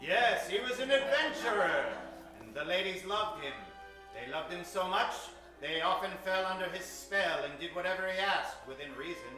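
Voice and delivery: ordinary talk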